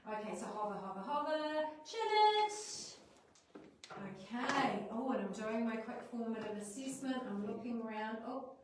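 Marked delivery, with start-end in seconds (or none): 0.5-3.0 sing-song hover. Shown as a demonstration. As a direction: chant it to the class. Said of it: "teacher cue to students".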